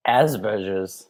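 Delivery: "Asperger's" stretched out as as-per-zherz; imitated show pronunciation. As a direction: echo "Asperger's" slowly with a zh for the g. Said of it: "What do they say?".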